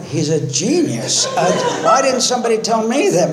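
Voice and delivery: mock amazement, pitch up high; sarcastic inner thought. To the AA room, mocking his advice with sarcastic awe. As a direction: scoff as if it's genius.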